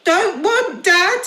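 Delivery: put-on high loud toddler bellow; bellowing baby. Baby bellows refusing to go to dad. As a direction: bawl it like a stubborn baby. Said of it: "bellowed the baby".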